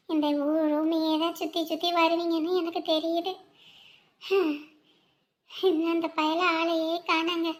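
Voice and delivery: worn-out, hushed grumbling to oneself; weary lament. Acted. Mother worn out, missing her son who stays away, weary lament.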